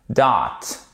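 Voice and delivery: "Dot." with a short clipped o; short dry vowel. Shown as a demonstration. Teacher contrasts the short vowel for students. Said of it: "un son beaucoup plus sec".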